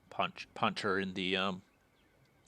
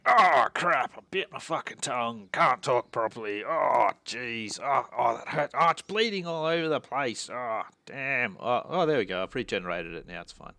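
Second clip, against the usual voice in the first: mock yelp over a bitten tongue, louder; hammed-up pained rant; 7 dB louder than usual. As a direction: overplay the pain, yelling. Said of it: "Maybe that's what he was saying".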